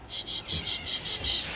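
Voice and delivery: real hushed shushing, unvoiced. Real, not acted. Mother hushing her kids during filming, soft shushing.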